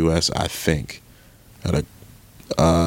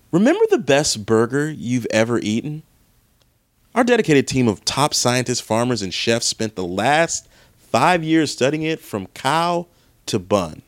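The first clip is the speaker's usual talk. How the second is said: lightly voiced ad announcer, higher; mock ad read; 5 semitones higher than usual. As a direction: read it like a commercial. Reading ad copy aloud.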